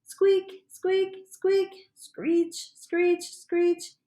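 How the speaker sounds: high little squeaks then screeches; hamster squeaks. Retold or imitated. Frog narrator mimics the hamster squeaking, high squeaks. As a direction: squeak like a tiny rodent.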